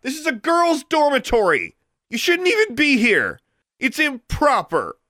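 high, indignant scolding shout; outraged, very high voice. A narrator's reading. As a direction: shout him out through the door. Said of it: "she shouted".

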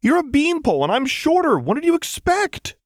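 high, loud defensive squeak; defensive protest. A narrator's reading. Lux defends her height to Jinx, high and indignant. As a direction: whine it out, stung pride.

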